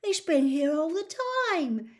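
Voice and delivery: light up with delight. A narrator's reading. Ron finding the car waiting, delighted. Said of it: "said Ron delightedly".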